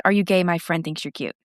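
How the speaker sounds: ordinary delivery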